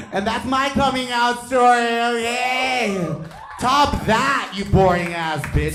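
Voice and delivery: high, loud, brash boast; triumphant shout. Real, not acted. Comic capping his coming out story, triumphant shout. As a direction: shout it as a showy challenge.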